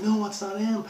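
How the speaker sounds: high-pitched woman's protest; mock panic. Woman telling kidnappers it's not him, flustered.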